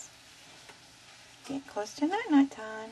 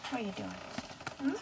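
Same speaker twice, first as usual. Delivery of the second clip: normal talking voice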